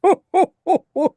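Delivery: very high, loud jolly Santa laugh. Shown as a demonstration. Voice actor demoing Santa's jolly laugh. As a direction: let out a big merry Santa laugh. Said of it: "Santa Claus".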